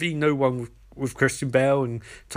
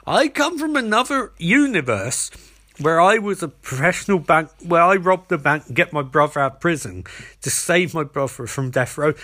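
impassioned mock confession; 3 semitones higher, 4 dB louder than usual. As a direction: blurt out the big reveal, hammed-up. Acted rambling confession of true identity.

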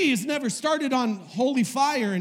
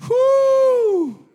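loud, high "whoo"; real preacher's whoop; excited whoop; 12 semitones higher, 7 dB louder than usual. Real, not acted. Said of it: "I'm exciting myself".

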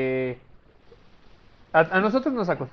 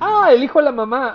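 surprised realization; 9 semitones higher, 9 dB louder than usual. Real, not acted.

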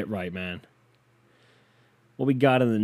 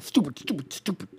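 pitched-up, put-on self-berating; squeaky mock reproach; mock self-scolding; 6 semitones higher than usual. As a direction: scold yourself for blowing the bit. Berating himself after botched bit.

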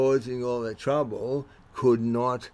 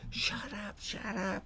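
higher, much softer hushed plea; hushing mate; 7 semitones higher, 14 dB quieter than usual. Retold or imitated. Mate hushing the drunk student, soft high plea. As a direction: shush your drunk friend urgently but softly. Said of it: "the other one saying".

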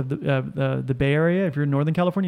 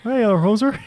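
hammed-up, higher Canadian lilt; Canadian accent; 5 semitones higher than usual. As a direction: tease in a mock Canadian accent. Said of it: "I have a Canadian accent".

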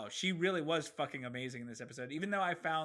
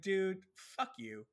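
slow dismissive retort; 7 semitones higher, much slower than usual. Retold or imitated. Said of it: "I was like".

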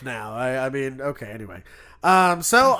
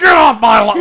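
loud, higher mock shout; screamed imitation; 6 semitones higher, 14 dB louder than usual. Grandfather shouting at kids in the front yard, mock scream. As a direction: holler it as the grandfather yelling at kids. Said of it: "screaming at us like".

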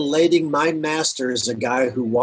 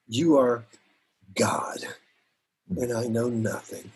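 humble prayer; 4 semitones lower, 6 dB quieter than usual. Pretend. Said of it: "humble myself and say".

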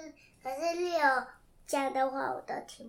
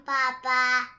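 much louder and slow; said loudly; 15 dB louder than usual. Real, not acted. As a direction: belt it out so dad can hear. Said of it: "太小声了，听不到。".

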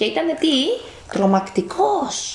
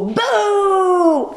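high drawn-out ghostly boo; 9 semitones higher, 3 dB louder than usual. Acted. Parent reading to child, a booming ghost boo. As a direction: spook the little ghosts with a big boo.

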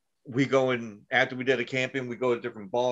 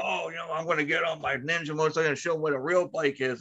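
lightly voiced cocky brother, pitched up; boastful brother; 4 semitones higher than usual. Brother brags about his Ninja motorcycle, lightly cocky. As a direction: imitate a brother showing off.

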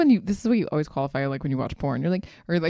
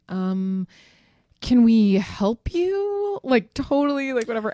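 slow, louder, condescending; snooty staff; 6 semitones higher, 6 dB louder, much slower than usual. Retold or imitated. Hotel staff greeting a scruffy car, slow and snooty. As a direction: drag it out, looking down at her. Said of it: "and they're like".

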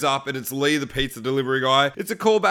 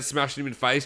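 usual speaking voice

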